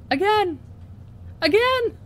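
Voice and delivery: high, loud cry of disbelief; exasperated cry. Real, not acted. Vlogger to viewers, her head back in frame, exasperated cry. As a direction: groan it out in disbelief.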